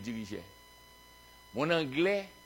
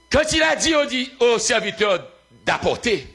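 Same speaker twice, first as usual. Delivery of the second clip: raised, high-pitched preaching; impassioned preaching; 7 semitones higher, 5 dB louder than usual. Real, not acted. Preacher retelling the servants' obedience, impassioned preaching. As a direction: drive the story home to the congregation.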